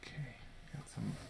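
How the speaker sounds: soft unvoiced whisper while crafting. Real, not acted. Crafting alone, whispering to herself. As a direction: whisper it to yourself.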